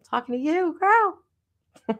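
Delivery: high and sassy, stretched out; sassy girl voice. Pretend. Streamer answering a viewer in a sassy high voice. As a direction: play it like a cheeky friend.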